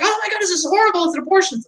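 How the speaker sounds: high-pitched mock alarm; mocking hysteria. Mimicking people who still believe the misinformation, high mock panic. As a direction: ham up a clueless panicked believer. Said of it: "so many people are still like".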